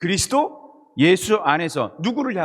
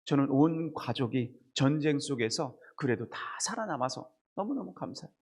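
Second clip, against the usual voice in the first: deacon's testimony; 4 semitones lower, 9 dB quieter than usual. Retold or imitated. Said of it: "한 집사님이 나오셔서".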